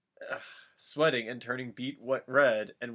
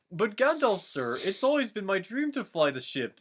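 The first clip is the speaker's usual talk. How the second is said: higher pleading hobbit voice; a hobbit begging his master, voice raised; Sam protesting; 7 semitones higher, 4 dB louder than usual. Acted. Sam pleading with Gandalf to fly the ship. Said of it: "Sam protested".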